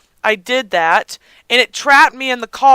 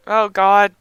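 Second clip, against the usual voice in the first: ordinary talk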